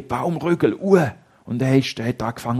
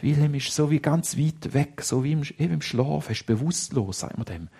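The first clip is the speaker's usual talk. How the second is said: ordinary delivery, nothing marked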